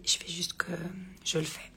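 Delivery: hoarse, breathy whisper; barely any voice left; raspy whisper. Real, not acted. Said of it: "je n'ai plus de voix".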